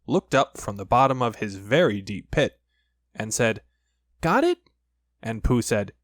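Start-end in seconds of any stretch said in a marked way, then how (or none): 4.2-4.7 high, small piglet voice. Acted. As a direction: squeak it up like a tiny piglet. Piglet's voice in story.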